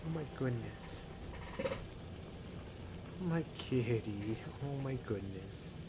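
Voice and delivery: soft, hushed doting coo; quiet tender cooing over a pet. Real, not acted. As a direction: murmur fondly to the cat. Cat cuddling on her lap.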